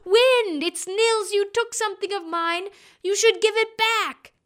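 high, loud demanding call; boy demanding his grain back from the wind. A narrator's reading. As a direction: shout down at the wind.

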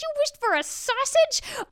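wife outraged her husband wasted a wish; shrieking disbelief. Acted. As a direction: let the disbelief explode upward. Wife repeats, rising outrage.

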